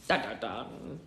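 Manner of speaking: low, drawn-out suspense sting; dramatic sting. Acted. Reader reacting to world-domination twist, mock-ominous. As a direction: sound the ominous cliffhanger chord by voice.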